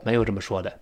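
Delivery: usual speaking voice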